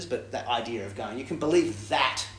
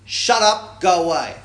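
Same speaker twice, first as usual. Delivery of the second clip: much louder, higher blunt rebuke; stern order; 5 semitones higher, 10 dB louder than usual. Retold or imitated. Jesus telling demons to leave, loud stern rebuke. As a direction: snap it like ordering a dog away. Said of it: "it's a stern, strict, authoritative".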